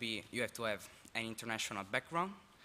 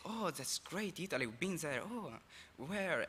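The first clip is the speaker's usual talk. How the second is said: high-pitched, gushing small talk; mock enthusiasm; 5 semitones higher, 4 dB quieter than usual. Exchange student mimics people hearing he's from Italy, mock enthusiasm. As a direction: imitate someone delighted to hear it.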